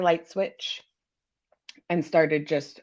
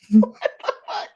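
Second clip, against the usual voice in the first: whispered disbelief; 5 semitones higher, 6 dB louder than usual. Real, not acted. Reacting to own Craigslist ad.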